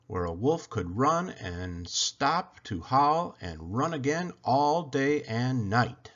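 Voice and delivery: ordinary delivery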